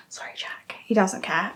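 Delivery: whispered aside; whispering. Real, not acted. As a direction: drop to a breathy whisper. Whispered aside about her husband.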